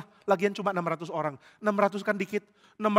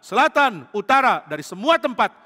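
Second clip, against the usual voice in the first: commander calling out; 6 semitones higher, 8 dB louder than usual. Acted.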